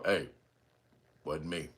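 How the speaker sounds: low deadpan denial, barely above a murmur; straight-faced denial. Retold or imitated. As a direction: mumble it low with a straight face. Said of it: "They got that face on".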